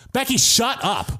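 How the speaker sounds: exasperated friend telling Becky to stop; mock exasperated. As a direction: imitate a friend losing patience.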